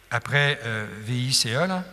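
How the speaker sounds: ordinary talk, nothing marked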